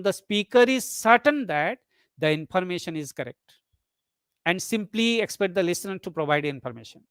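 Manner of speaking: normal talking voice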